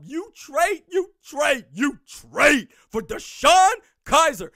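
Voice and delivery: building outrage. Real, not acted. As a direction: pile up the disbelief, then hammer the name. Stunned at a backup trade.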